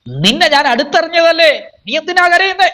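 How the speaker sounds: high, insistent preaching cry; impassioned divine reproach, pitched high; God challenging Moses. Acted. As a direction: press the question upward in pitch. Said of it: "നിന്നോട് കർത്താവ് പറയുക".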